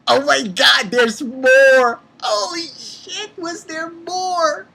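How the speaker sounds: loud high cry thinning to a drawn-out squeal; overwhelmed fan, voice climbing; hyped disbelief. Real, not acted. Hyped reviewer announcing more.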